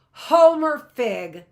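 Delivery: put-on a grinning lawyer claps and greets the boy, delighted; delighted exclamation. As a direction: savor the name with sudden glee. Frank suddenly grins, claps hands.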